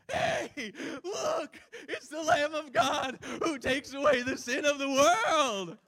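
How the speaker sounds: hammed-up excited preacher bellow, very high and loud; Pastor Tim. Preacher imitates his excitable pastor colleague, over-excited shouting. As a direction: shout it slowly at the top of your voice. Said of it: "He gets very excited sometimes".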